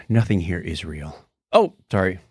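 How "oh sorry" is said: ordinary talk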